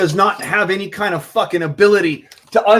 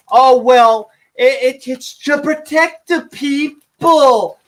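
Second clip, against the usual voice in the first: higher, slow, whiny mock of the other side; mocking gun-control voice; 6 semitones higher, 3 dB louder, slower than usual. Ranting gun owner mocks lawmakers in a slow, higher whiny voice. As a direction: sneer it like a preachy do-gooder. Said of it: "because they feel fucking".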